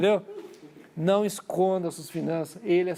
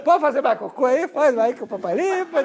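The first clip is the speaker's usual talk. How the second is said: mock-soothing dad, high and loud; exaggerated calm; 8 semitones higher, 8 dB louder than usual. Father hastily reassures daughter after the rebuke, comically exaggerated calm. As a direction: overdo the reassurance in a raised, high voice. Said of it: "fiquei calmo".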